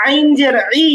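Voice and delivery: "ই" squeezed from the middle of the throat; firm throaty Arabic ain in "আইন জের ই". Shown as a demonstration. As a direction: press "আইন" and "ই" from mid-throat. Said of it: "এটা একটু গলার মাঝখানে নিতে হবে".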